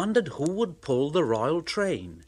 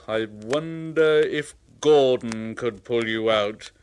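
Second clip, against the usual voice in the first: lightly voiced thoughtful boss; pondering fat controller; 6 dB louder than usual. Fat controller musing how to rescue Thomas. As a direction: muse it over like an official.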